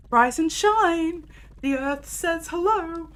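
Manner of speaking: slow, high, mock sweet wake-up call; gentle wake-up. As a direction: coo someone awake, slowly. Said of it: "It's not a gentle, like, shoulder shake".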